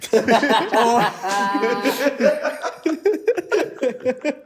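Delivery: loud high cackling laugh. Real, not acted. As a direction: burst out cackling. Big laugh at clip.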